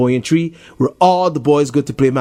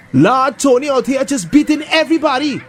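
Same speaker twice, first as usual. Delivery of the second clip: put-on excited boy; 12 semitones higher than usual. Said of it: "one of the boys say".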